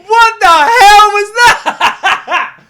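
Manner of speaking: slow, loud, laughing disbelief; half-shouted laugh of shock. Real, not acted. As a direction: yell it through real laughter. Stunned reaction to a rap verse.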